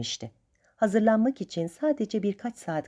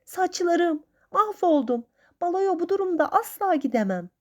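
high-pitched distraught lament; acted high tearful outburst; tearful wailing; 11 semitones higher than usual. A narrator's reading. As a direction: wail in dismay, close to tears. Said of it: "ağlamaklı bir sesle".

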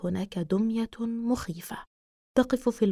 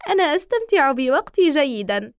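higher, louder gloating doll; creepy doll voice; 4 semitones higher, 5 dB louder than usual. Acted. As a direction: gloat like a sinister toy. Doll gloats after dodging.

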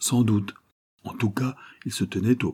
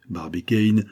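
usual speaking voice

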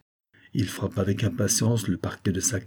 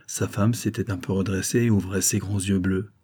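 usual speaking voice

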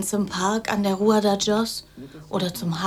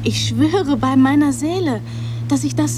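laute Beteuerung; 6 semitones higher, 4 dB louder than usual. Acted. Beteuert heftig gegenüber Olympico.

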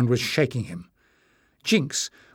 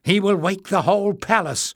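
urgent, much higher plea; raised, anxious warning; begging; 8 semitones higher, 3 dB louder than usual. A narrator's reading. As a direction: plead with him to stop. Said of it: "Leaf begged".

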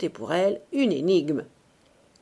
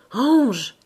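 higher, louder exclamation in a put-on voice; Gwynplaine; 5 semitones higher, 7 dB louder than usual. A narrator's reading. Ursus imitating the young man, adoring put-on voice. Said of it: "Il reprit avec la voix de Gwynplaine".

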